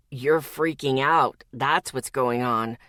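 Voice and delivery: low, slow, level male voice; annoyingly calm. Acted. Zeke answers the shouting Lexi, low, slow and calm. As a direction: stay infuriatingly calm. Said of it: "His voice never lost that annoying calm".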